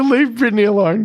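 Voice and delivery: high tearful plea, a bit mocking; Woody in tears. Retelling the meeting to the host, mock high tearful plea. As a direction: mimic the tearful plea as a cliché line. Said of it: "Woody bursts into tears".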